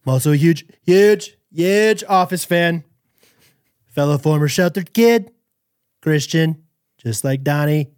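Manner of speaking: emphatic impression of a boastful politician; mock presidential bragging; Donald Trump. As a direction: punch the repeated word like the politician at a rally. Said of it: "do it in a Trump impression".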